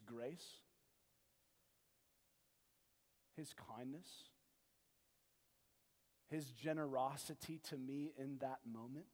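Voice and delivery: soft, low pastoral voice drawing the listener in; hushed sermon voice asking the congregation; intimate preaching. Acted.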